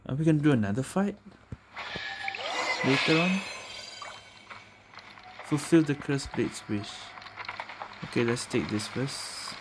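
normal talking voice

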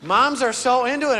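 ordinary talk

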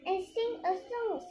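high-pitched cheerful request; happy dwarf. A narrator's reading. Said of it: "says the happy dwarf".

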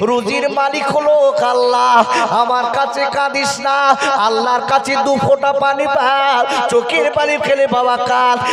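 chanted sermon storytelling; sing-song preacher's waz, full voice; rhythmic, stretched-out sermon chant; preaching. Acted.

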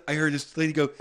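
ordinary talk

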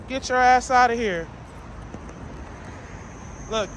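ordinary delivery

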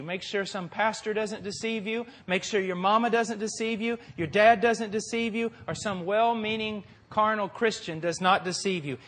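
ordinary talk

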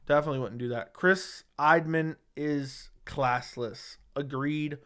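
usual speaking voice

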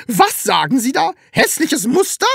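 high, loud indignant question; scandalized landlady's yell; outraged shout. A narrator's reading. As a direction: shriek it back in disbelief. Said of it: "schrie sie".

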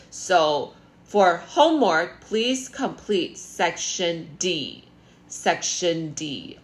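spelled out slowly for class; slow, emphatic teacher instruction; slow and deliberate. Shown as a demonstration. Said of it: "slow homework instruction".

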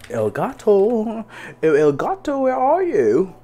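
high, drawn-out singsong call; playful calling out. Real, not acted. Streamer searching for El Gato, high singsong calling. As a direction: call out high and slowly, stretching the name.

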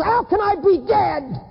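high, drawn-out cries of anguish; anguished cry. Acted. Damned man feeling pain in hell, anguished cry. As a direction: wail it out in disbelief.

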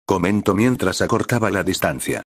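plain reading voice

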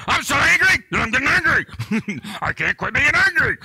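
high, loud hammed-up frustration; a comic burst of self-directed rage; mock angry rant. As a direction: play the man furious at his own anger.

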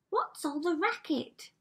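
high-pitched Cindy voice; crying out. A narrator's reading. Cinderella poking her head round the door, crying out. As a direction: call out high, as a startled girl. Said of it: "Cindy cried".

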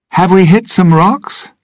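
very high, loud called question; calling out. A narrator's reading. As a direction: call out in alarm to the others. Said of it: "she called".

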